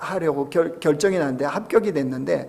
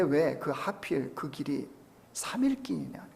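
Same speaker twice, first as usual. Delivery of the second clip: whisper; 2 semitones lower, 10 dB quieter than usual. Acted. Sermon question about the journey.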